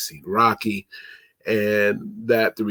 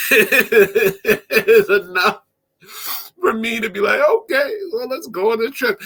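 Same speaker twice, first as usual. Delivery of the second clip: much higher, through laughter; laughing while talking; 12 semitones higher, 3 dB louder than usual. Real, not acted. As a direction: giggle the words out. Laughing at the scene.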